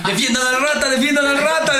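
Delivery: high-pitched rallying plea; retold urgent call. Gamer telling teammates to protect the kid from zombies, retold urgent call. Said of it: "decía yo".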